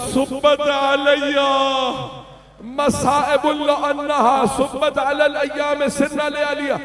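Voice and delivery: mournful keening lament; weeping lament. Acted. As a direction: chant the Arabic as if through tears. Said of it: "बीबी ने रो के कहा".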